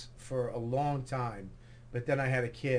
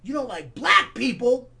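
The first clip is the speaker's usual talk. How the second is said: higher, louder challenge; imitated affronted stranger, raised voice; acted loud accusation; 8 semitones higher, 8 dB louder than usual. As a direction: throw the accusation at him in his voice. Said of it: "and he goes".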